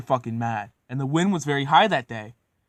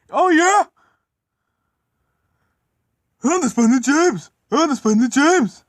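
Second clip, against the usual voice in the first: high, loud whooping brag; boastful shouting; 12 semitones higher, 8 dB louder than usual. Acted. James showing off on the turntable, loud high boasting. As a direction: crow it out at full volume, repeating the boast.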